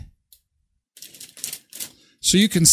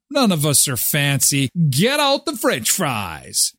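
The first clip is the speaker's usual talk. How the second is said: normal talking voice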